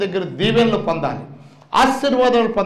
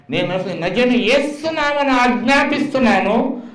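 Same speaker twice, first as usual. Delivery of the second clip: raised, ringing prayer of command; 3 semitones higher than usual. Retold or imitated. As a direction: order the illness out in prayer. Commanding prayer over coma child.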